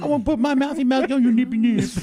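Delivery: put-on high loud baby-talk voice. Shown as a demonstration. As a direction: coo it loudly like baby talk.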